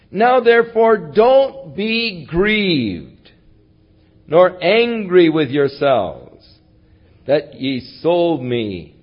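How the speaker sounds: slow comforting plea. Acted. Joseph comforts brothers, slow.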